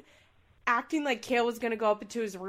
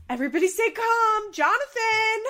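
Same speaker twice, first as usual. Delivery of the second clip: much higher, louder prim mom voice; mock mom voice; 12 semitones higher, 9 dB louder than usual. Mom trying to calm her son, high and loud. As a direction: ham up the fussy mom pleading.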